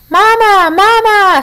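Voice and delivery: high, small peeping gosling voice; tiny egg voice. A narrator's reading. Unhatched gosling calling to the robot it thinks is its mother. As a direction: peep like a chick still in its shell. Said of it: "said the egg".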